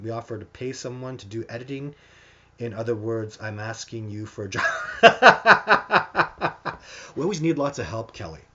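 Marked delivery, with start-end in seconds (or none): 4.7-7.1 high, very loud staccato laughter; hearty laugh. Real, not acted. Host reacts to a viewer asking for a job, hearty laugh. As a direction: break into a long, high belly laugh.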